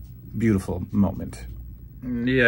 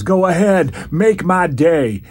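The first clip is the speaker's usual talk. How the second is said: slow, loud, higher movie tough-guy line; Orndorff impression; 7 semitones higher, 7 dB louder, slower than usual. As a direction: deliver it like a cheesy action hero. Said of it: "and Orndorff says".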